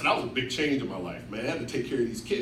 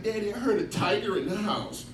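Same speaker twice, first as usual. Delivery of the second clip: put-on higher, small child's voice; little girl voice; 8 semitones higher than usual. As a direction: say it like a wide-eyed little daughter. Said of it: "She's like".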